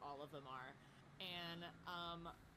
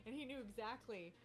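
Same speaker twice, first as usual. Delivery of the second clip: ordinary talk, nothing marked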